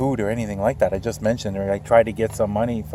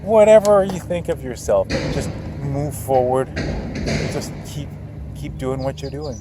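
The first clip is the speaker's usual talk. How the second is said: rising and louder, cheering viewers on; rousing encouragement; 4 semitones higher, 4 dB louder than usual. Real, not acted. Encouraging viewers to step forward, rising excited push. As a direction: pump them up, peaking on whatever.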